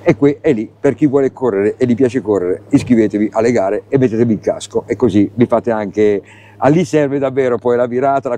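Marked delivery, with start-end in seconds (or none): none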